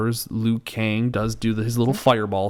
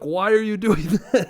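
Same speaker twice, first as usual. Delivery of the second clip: half-laughing, higher mock question; laughing disbelief; 8 semitones higher than usual. Teasing his friend about liking the movie, laughing. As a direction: tease him through a laugh. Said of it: "I'm like".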